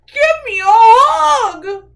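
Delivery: loud, high, drawn-out plea; crying out. Acted. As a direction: shout it slowly, begging for a cuddle. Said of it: "cried Douglas".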